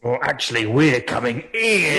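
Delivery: loud, slow, defiant challenge; defiant shout. Acted. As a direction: yell it back at the ghost. Barbarian answers ghost defiantly.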